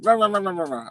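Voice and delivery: high-pitched mimicked rambling; imitated blabbering over the phone; mock rant. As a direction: rattle off nonsense syllables like someone ranting. Mimics caller's rant as nonsense syllables.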